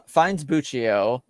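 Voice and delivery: ordinary talk, nothing marked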